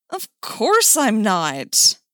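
loud, slow, high protest; mock-offended denial. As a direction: deny it indignantly, stretching the words. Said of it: "Duke protested".

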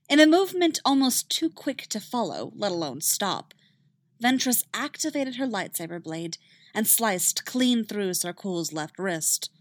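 ordinary delivery

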